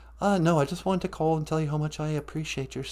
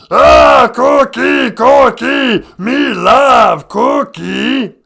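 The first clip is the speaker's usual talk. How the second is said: much higher shouted slow monster growl; Cookie Monster; 12 semitones higher, 14 dB louder, much slower than usual. Acted. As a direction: bellow for cookies like a ravenous monster. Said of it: "Hey, Cookie Monster".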